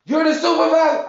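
mock shocked squeal from the caller. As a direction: squeal it like she just got caught out. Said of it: "if you could have heard the voice on this lady now".

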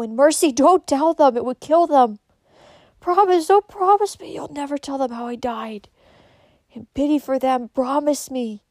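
high, loud, urgent entreaty; desperate pleading. A narrator's reading. Kate begs them not to tell, desperate pleading. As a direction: beg with rising distress, easing toward the end. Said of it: "pleaded Kate".

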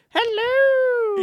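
very high, loud, drawn-out hello; Mrs. Doubtfire. Acted. Host hamming up a greeting, high falsetto. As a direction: trill it out in a silly falsetto. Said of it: "sound like Missus Doubtfire".

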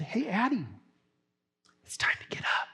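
soft, breathy wake-up whisper at the bedside; gentle whisper. Retold or imitated. Dad gently waking his sleeping daughter, soft whisper. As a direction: whisper so as not to startle her.

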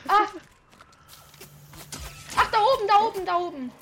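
high, loud urgent calling; alarmed shout. Real, not acted. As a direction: call out the enemy position. Spots enemy above.

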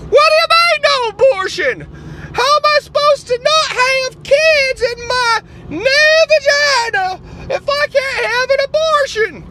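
high, loud indignant activist voice; outraged liberal caricature. Acted. Liberal character again.

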